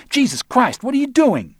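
high, loud protest; outraged yell. Acted. Arnold protests the scratched record, outraged high yell. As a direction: cry out in indignant alarm.

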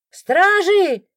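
high, loud call for the guards; sudden cry. A narrator's reading. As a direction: cry out in alarm to summon help. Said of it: "вдруг кто-то выкрикнул".

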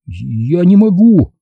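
slow, high-pitched helpless confession; distressed admission. A narrator's reading. Boy cannot mark his dying father, helpless and distressed. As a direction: admit it as if overwhelmed. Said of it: "признался он".

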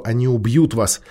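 ordinary delivery

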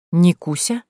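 astonished question with rising end; a puzzled, lifted query on the name; amazed. A narrator's reading. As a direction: echo the name in disbelief. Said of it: "Изумление в голосе Дмитрия Васильевича было столь сильным".

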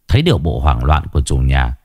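plain reading voice

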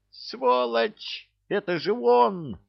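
very high-pitched accusing cry; piercingly high, outraged outburst; girl's exclamation. A narrator's reading. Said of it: "воскликнула девчонка".

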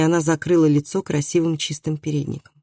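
plain reading voice

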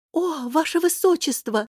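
high, flustered cry of deference; startled exclamation. Acted. Fat tailor amazed to see Her Highness, startled.

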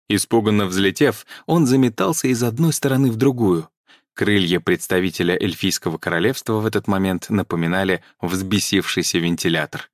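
ordinary delivery, nothing marked

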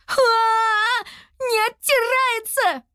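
high, drawn-out cry of horror; panicked wail. A narrator's reading. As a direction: wail in panic, stretching the words. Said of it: "заорала она". (панический вопль)

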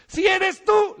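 high, loud shout. Retold or imitated. Peter shouting from the boat to Jesus, high and loud. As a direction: yell it up over the wind. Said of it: "le grita Pedro".

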